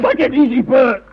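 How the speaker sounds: agitated plea for calm; urgent calming call. Acted. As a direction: cut through his outburst to settle him. Calls to calm the shouting man.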